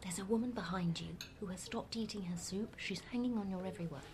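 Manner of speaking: murmured under the breath, confidential; hushed warning. Acted. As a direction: whisper it so the next table can't hear. Warning of an eavesdropper behind him.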